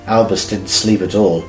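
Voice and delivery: usual speaking voice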